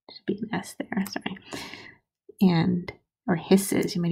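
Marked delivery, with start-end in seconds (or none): 0.1-1.4 whisper. Real, not acted. Aside correcting a mistake.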